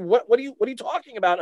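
high-pitched, bewildered mom questioning; baffled mother. Retold or imitated. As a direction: imitate a mom thrown by her kid's panic. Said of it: "And she's like".

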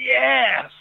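high, loud "Yes!" shout; ring man's yell. Retold or imitated. Auctioneer's ring man shouting a bid to the room, yelled. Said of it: "my ring man yells".

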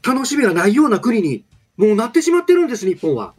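impassioned, high-pitched Kansai-tinged political speech; Yamamoto Taro impression. Announcer obliges a listener's request for a politician, impassioned high-pitched impression. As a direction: plead like a fiery opposition politician, pausing between bursts. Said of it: "某山本さんのモノマネ".

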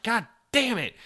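high, strained mock exasperation; a hammed-up frustrated outburst; exasperated curse. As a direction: groan it out, fed up. Long trek back to objective.

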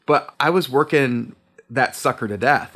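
ordinary delivery

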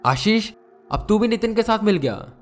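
high, fast accusing outburst; angry shouting. A narrator's reading. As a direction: round on a friend in exasperation. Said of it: "गुस्से में खड़ा होते हुए चिल्लाने लगा".

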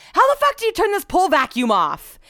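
high, loud demanding holler; exasperated shout to someone inside; retold yell. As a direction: re-enact yelling through a window. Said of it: "yell at Scott for information".

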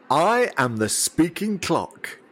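slow, grand recorded announcement; talking clock phone voice. Retold or imitated. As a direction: announce it slowly like the speaking clock. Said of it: "instant fame".

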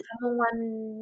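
塔蒙湾 repeated slowly and softly, stress on 湾; careful imitation of 塔蒙湾 with the end stressed; trying the name. Shown as a demonstration. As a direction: learner copies 塔蒙湾, stress moved to the end. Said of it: "那我的发音对吗？".

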